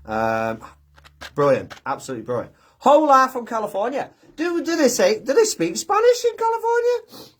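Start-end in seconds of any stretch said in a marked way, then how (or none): 2.8-7.3 amused surprise. Real, not acted. Amused reading a viewer comment.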